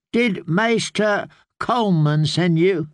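put-on a frail child calling from bed; sickly boy voice. Boy lord voiced in story.

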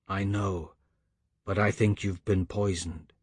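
low, soft, sorry voice; hushed, regretful verdict from the man on the line; grave, regretful caller. A narrator's reading. Caller apologises to Lucas before acting, quietly regretful.

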